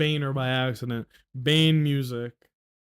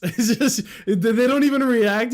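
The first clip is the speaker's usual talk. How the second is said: laughing; 8 semitones higher, 5 dB louder than usual. Real, not acted. Laughing at clip.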